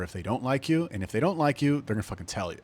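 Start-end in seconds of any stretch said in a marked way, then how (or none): none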